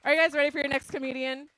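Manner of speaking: loud, fast emcee call; hype host call. Real, not acted. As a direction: pump the crowd up. Host hyping crowd.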